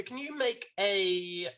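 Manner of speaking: ordinary talk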